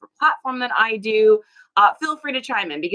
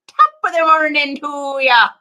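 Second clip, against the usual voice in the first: loud, high put-on Irish greeting; hammed-up Irish lilt; Irish accent; 7 semitones higher, 9 dB louder than usual. Shown as a demonstration. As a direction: belt it out in a playful Irish accent. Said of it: "allowed to do that accent".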